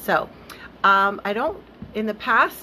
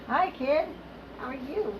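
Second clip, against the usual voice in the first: high, soft cooing to a pet; pet talk; 6 semitones higher, 6 dB quieter than usual. Real, not acted. Host greets her cat who wandered on camera, soft high pet talk. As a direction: greet your kitty sweetly, small and high.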